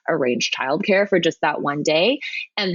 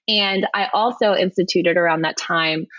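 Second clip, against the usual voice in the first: ordinary talk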